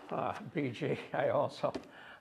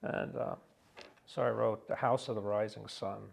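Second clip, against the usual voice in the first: normal talking voice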